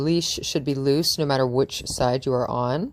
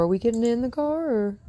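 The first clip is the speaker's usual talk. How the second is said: asking the dog; 9 semitones higher than usual. Real, not acted.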